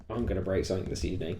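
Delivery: low, quick, muttered forecast; quiet ominous prediction. Retold or imitated. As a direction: say it like a grim prophecy. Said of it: "I can go".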